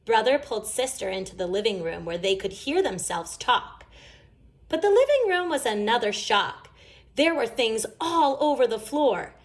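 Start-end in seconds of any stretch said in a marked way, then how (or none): none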